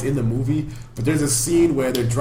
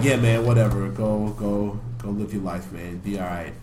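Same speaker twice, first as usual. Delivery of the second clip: lower, fading, flat mumble; down and depressed; 6 semitones lower, 5 dB quieter than usual. Retold or imitated. Depressed friend brushing off his old pal, sinking and soft. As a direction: let it sink away, dejected. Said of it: "he's just kinda down".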